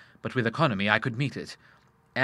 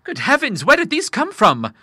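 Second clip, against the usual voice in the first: high, louder bewildered question; astonished; 12 semitones higher, 6 dB louder than usual. A narrator's reading. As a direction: ask in sheer wonder. Continued astonishment.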